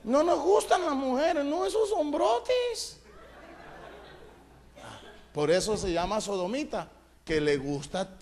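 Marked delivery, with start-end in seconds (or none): 0.0-3.0 mock camp disgust. Sodom's men refusing Lot's daughters, high laughing camp disgust. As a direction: recoil in camp horror, then drool over the men.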